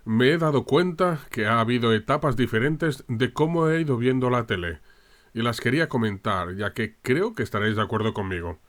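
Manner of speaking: usual speaking voice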